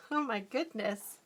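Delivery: ordinary talk, nothing marked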